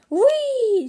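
high, loud joyful squeal; excited whee. Acted. Wossie squeals diving toward home. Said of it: "said Wossie".

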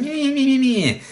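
very high whiny meh meh, mimicking complaining trainees; mocking whine. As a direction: whine the meh sounds up high like a nagging kid. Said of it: "So they're just going".

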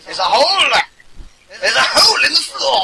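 loud, high alarmed yell; panicked shouting. Real, not acted. Panic in mob attack.

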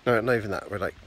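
ordinary talk, nothing marked

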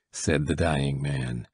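plain reading voice

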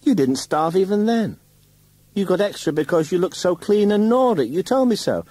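very high, loud, taunting; wife taunting husband, very high and loud; scornful mockery. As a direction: sneer at him from high above. Wife mocks husband shrilly.

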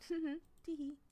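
high, cutesy tee-hee; giggly tee-hee; giggle. Acted. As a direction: giggle playfully. Giggling over the keychain.

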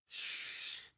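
whispered shush to silence them; hushing whisper. Acted. Howdy hushing the screaming neighbour in a whisper.

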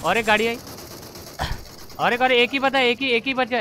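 loud, high panicked hype; excited shouting. Real, not acted. Tense clutch moment.

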